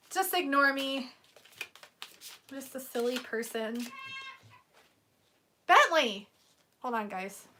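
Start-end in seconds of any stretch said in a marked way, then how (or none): 5.7-6.3 calling out. Real, not acted. Calling the dog.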